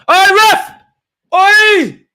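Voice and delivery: high, loud yell at the referee; shouting at the ref. Real, not acted. Fan yelling at the referee over a missed foul, loud high shout. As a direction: roar it out at the ref.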